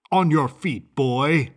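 loud, barked order; commanding. A narrator's reading. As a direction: command him to stand. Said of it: "commanded the strong man".

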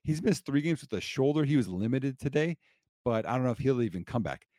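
usual speaking voice

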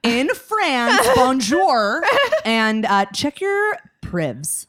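loud, high, stretched-out call to a faraway listener; mock shout-out. Host jokingly hails Claude in France, loud mock shout-out.

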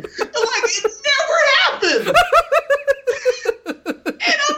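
high-pitched, laughing through the words; cracking up while telling it; laughing hard. Real, not acted. Cracking up retelling the joke.

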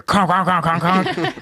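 high, rapid knocking noise; imitated door rattling. Comic mimicking a customer rattling the dealership doors, imitated rattle. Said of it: "you just hear".

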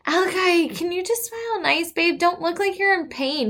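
high-pitched coaxing plea; pleading mom. Retold or imitated. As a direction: coax a kid in a high, sweet pitch. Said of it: "So I told him, I'm like".

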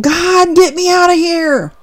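high pleading cry of get me out; mock scream to God. Preacher voicing believers crying to God, high plea. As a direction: cry out to God half-acted, pitched high. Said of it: "We're screaming".

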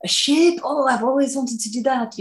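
put-on excited young girls. Young girl telling a friend about living on a ship, gushing and high. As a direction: play a starry-eyed girl cheering her friend on. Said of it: "they're like".

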